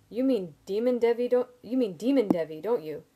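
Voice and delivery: loud, high-pitched alarm; aghast. A narrator's reading. Simon dreading it is Demon Devi, aghast. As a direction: blurt it out loudly in horror.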